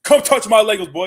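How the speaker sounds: high, loud playful dare; hammed-up threatening shout; mock threat. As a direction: holler it as a joking challenge. Mock threat about gun on chair.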